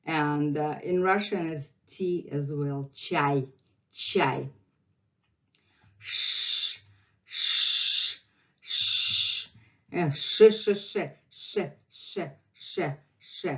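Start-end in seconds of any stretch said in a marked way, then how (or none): 6.0-13.5 sh, sh hissed without voice; sh sound drill. Shown as a demonstration. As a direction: hold a long sh. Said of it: "very interesting sound".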